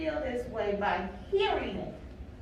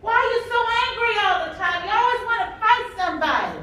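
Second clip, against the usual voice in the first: put-on higher and louder, a frustrated adult nagging a sullen kid; exasperated scolding adult; 8 semitones higher, 5 dB louder than usual. Adult scolding an angry child who won't behave, exasperated and raised. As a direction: scold the child like you've run out of patience. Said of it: "we're gonna look at them and say".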